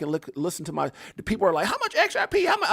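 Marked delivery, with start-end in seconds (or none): 1.7-2.7 much higher, imitating an anxious asker; mock high-pitched questioning; mimicked eager questioner. Host imitating viewers asking how much, high mock voice.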